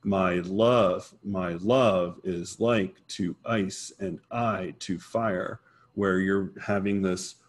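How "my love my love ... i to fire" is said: slow beat, leaning on every second syllable, as in "my LOVE"; iambic stress. Shown as a demonstration. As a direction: stress "love", "like", "ice", "I", "fire" in "my love is like to ice and I to fire". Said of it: "there's the iambic pentameter".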